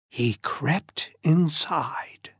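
soft, slow stage whisper; hushed, sneaky. A narrator's reading. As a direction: whisper it as if creeping in.

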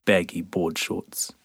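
usual speaking voice

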